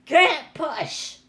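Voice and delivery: high squeaky troll cry, loud; whiny little troll refusing. Acted. Trolls crying out to the girl that they can't push, high and loud. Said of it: "they cried".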